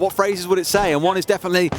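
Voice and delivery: ordinary talk, nothing marked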